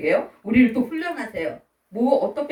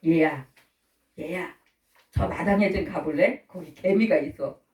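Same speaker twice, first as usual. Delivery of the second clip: lower-pitched, tender coaxing; gentle exhortation; 5 semitones lower than usual. Acted. God sending a child to the ants, gently. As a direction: call the child kindly and coax. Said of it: "이렇게 하면서 하나님은 권면하시고".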